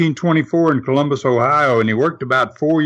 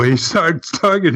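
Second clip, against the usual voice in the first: ordinary talk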